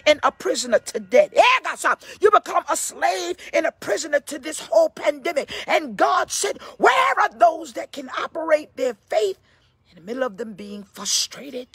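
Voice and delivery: forceful, driving sermon voice; full-throated church exhortation; fervent preaching. Real, not acted. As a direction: preach it hard, punching and stretching key words.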